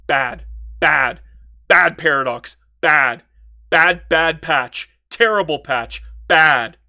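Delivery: loud, slowing rant at the patch; loud frustrated rant at the developers; angry ranting. Real, not acted. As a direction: punch each word out in frustration.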